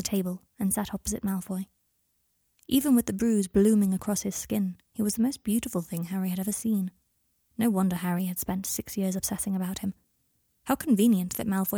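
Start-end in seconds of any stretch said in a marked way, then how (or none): none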